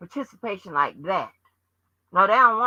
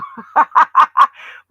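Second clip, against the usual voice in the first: sharp high cackle, much louder; high loud laugh; 8 semitones higher, 11 dB louder than usual. Real, not acted. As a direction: burst out laughing mid-rant. Laughs mid rant.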